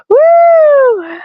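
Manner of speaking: high, loud "woo"; whoop. Real, not acted. Recovering from a laughing fit on camera, high whoop.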